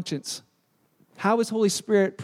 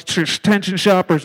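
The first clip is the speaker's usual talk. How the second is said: store announcer; 4 semitones lower, 6 dB louder than usual. Retold or imitated. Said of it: "That voice from above".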